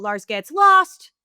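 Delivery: LOST cried out loud and high; mock-dramatic title with a loud last word; alarmed exclamation. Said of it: "every time there's more exclamation points".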